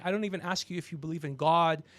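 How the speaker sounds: ordinary talk, nothing marked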